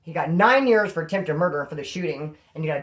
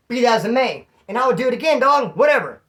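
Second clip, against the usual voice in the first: defiant; 7 semitones higher, 7 dB louder than usual. Real, not acted. Defiant boast about the fight.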